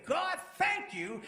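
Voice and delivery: high, drawn-out cry to God; fervent prayer cry. Retold or imitated. Acts out prayer on knees.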